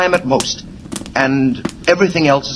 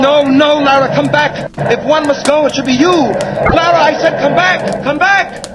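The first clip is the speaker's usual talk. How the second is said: shouting after his wife, higher and higher; desperate calling; 9 semitones higher than usual. Acted. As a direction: call her back in rising panic. Calling wife back in panic.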